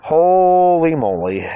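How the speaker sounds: slow, amazed holy moly. Real, not acted. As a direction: draw it out in amazement. Amazed how fast it went.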